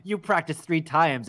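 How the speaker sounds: ordinary talk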